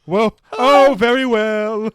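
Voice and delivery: loud, high, smug; mock Newman. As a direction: Newman playing Pontius Pilate, loud and smug. Newman as Pilate.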